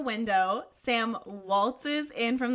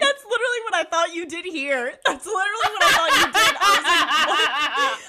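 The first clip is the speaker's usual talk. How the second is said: loud, high, cracking up; real laughing excitement; 5 semitones higher, 9 dB louder than usual. Real, not acted. Co-host amazed they both thought the same, laughing loudly. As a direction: blurt it out fast through big laughter.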